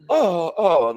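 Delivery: high-pitched reeling oh; mock punched reaction. Acting out man getting punched.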